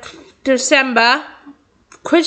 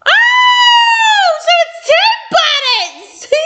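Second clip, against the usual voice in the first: excited shout; much slower than usual. Real, not acted. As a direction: blurt it out in delighted surprise. Realizing the teleport trick.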